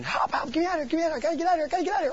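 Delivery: high, frantic cries for help, far above the speaker's own voice; panicked screaming. Retold or imitated. Pastor voices the girl panicking in her sleep, frantic high screams. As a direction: shriek it like a girl trapped in a nightmare. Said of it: "just started screaming".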